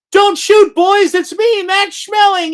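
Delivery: high, loud hammed-up German boxer pleading; Max Schmeling surrendering. The boxer surrendering to American soldiers, hammed-up pleading. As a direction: call out to the troops, high and frantic, then chummy. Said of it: "and he'd go".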